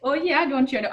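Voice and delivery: lightly put-on Minnesota voice, friendly; Midwestern accent. Teasing co-hosts in a mild Midwestern accent. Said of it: "if you do the Midwestern accent".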